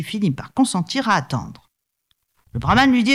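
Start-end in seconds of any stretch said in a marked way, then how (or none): none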